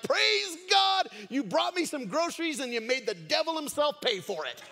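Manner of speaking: loud, high, jubilant shouting; hammed-up ecstatic praise holler; excited. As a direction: whoop it out as if dancing for joy. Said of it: "she started getting excited and dancing".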